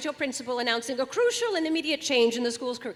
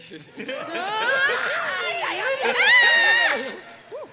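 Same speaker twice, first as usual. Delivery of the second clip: cackling laugh; 12 semitones higher, 6 dB louder than usual. Acted. Big laugh.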